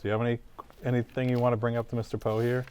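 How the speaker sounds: ordinary talk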